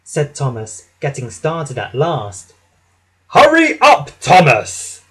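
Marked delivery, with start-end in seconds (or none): 3.3-5.0 loud, impatient order starting high; cross. A narrator's reading. Gordon to late-starting Thomas, cross shout. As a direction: chivvy a dawdler crossly. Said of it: "said Gordon crossly".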